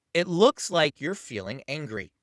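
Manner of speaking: slow, deliberate naming of the feeling. Shown as a demonstration. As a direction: spell it out calmly and slowly. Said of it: "simply say this".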